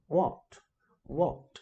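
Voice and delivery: British "what" with a rounded O vowel; a British-sounding "what", like "wot"; teacher demoing British "what"; British accent. Shown as a demonstration. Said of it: "inglês do britânico, que eles falam mais puxado pro O".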